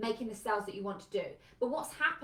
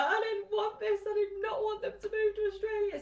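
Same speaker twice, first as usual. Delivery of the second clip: higher, louder, tearful wailing protest; bawling; 10 semitones higher, 3 dB louder than usual. Retold or imitated. Parent alone after the child's Australia news, mock bawling. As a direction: wail it out like a parent crying alone. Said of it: "you're bawling your eyes".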